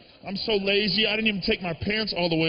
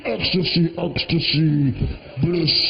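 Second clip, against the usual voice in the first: low, loud, drawn-out moaning of bliss; hammed-up ecstatic moan; mock ecstasy; 4 semitones lower, 7 dB louder, slower than usual. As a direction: groan it like pure pleasure. Acting out climax moans.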